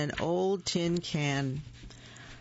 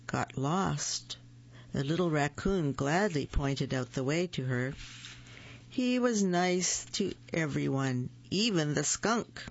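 ordinary delivery, nothing marked

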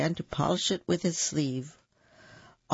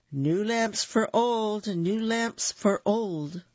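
street vendor calling; 6 semitones higher, slower than usual. A narrator's reading. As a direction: call it out down the street, slowly. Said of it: "came walking through the streets crying".

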